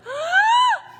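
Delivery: high, loud gasp of hurt shock. Retold or imitated. Partner hears a flat yes and gasps in shock. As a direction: gasp as if stung.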